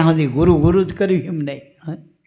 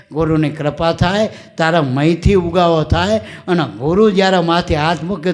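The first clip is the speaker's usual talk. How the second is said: ordinary talk, nothing marked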